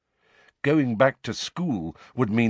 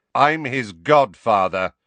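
loud, slow, emphatic assertion; 3 semitones higher, 7 dB louder, slower than usual. A narrator's reading. Sirius claiming his place beside Harry, very loud. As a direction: assert your right, loud and deliberate. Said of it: "louder than ever".

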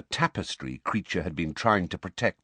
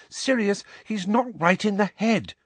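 put-on high, pleading girl voice; 3 dB louder than usual. A narrator's reading. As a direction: beg him to understand. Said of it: "Hermione pleaded".

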